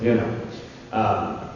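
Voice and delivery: ordinary delivery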